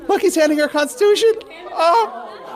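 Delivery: high, loud, gushing delight. Real, not acted. Professor to class, spotting a child holding a Constitution, high delighted gush. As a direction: gush over the little one with the Constitution.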